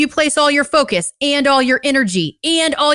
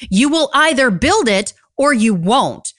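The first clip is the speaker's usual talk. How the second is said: ordinary delivery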